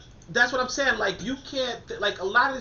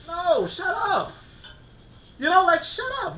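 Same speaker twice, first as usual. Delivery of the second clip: dismissive shut up; 10 semitones higher than usual. Real, not acted. Dismissing a mock quoted argument.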